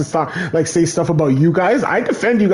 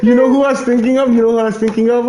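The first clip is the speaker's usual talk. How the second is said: excited; 6 semitones higher than usual. Real, not acted. Trying to recall another player, excited and eager. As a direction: blurt it out, keyed up.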